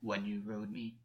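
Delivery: low, hushed character voice; voice not quite his own. A narrator's reading. Gwen answering August's intimate question, low unsteady-selfed murmur. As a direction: drop deep, as if not quite yourself. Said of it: "voice still not quite his own".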